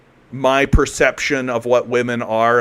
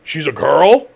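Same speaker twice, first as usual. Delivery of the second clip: mild mock surprise; 4 dB louder, slower than usual. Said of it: "it being like".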